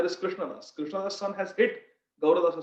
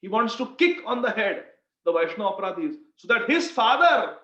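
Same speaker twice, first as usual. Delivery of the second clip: normal talking voice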